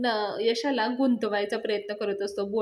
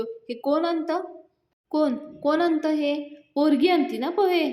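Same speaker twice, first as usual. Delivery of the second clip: higher, louder indignant demand; angry retort; 6 semitones higher, 5 dB louder than usual. Retold or imitated. Groom to Datta, offended by the poha: angry demand. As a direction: snap at them, offended. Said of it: "तो मुलगा चिडतो".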